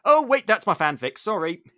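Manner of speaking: mock-embarrassed outburst; sudden self-interruption. Real, not acted. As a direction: snap out of character. Breaks out of character, joking.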